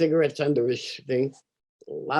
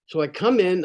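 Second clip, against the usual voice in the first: ordinary delivery, nothing marked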